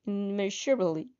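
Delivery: plain reading voice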